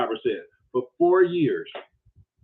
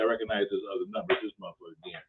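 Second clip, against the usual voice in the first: fast, much softer and lower grumble; muttered aside; 5 semitones lower, 11 dB quieter, much faster than usual. Retold or imitated. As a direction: mutter it under your breath. Muttering to Daryl about caller.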